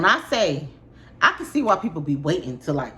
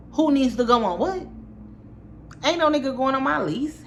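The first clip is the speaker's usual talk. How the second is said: indignant retort; 9 semitones higher than usual. Retold or imitated. Retelling lease argument, indignant.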